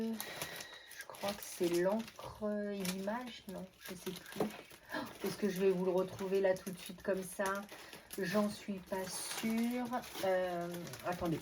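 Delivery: absent-minded searching. Real, not acted. Crafter searching for a stamp mid-video, quiet and absent-minded. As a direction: think aloud quietly while hunting for something. Searching, half talking to herself.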